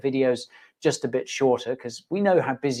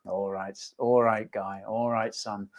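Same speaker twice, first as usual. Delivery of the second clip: mock parent, lower and softer; resigned parent voice; 4 semitones lower, 5 dB quieter, slower than usual. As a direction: give in quietly as the parent. Voices parent answering the returning son.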